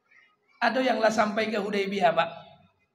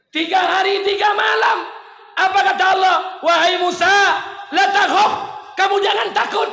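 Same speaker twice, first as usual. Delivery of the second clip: shouted much higher and louder; fervent shout; 10 semitones higher, 12 dB louder than usual. Acted. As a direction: cry it out with full force. Preacher shouting, voicing God. (teriakan berapi-api)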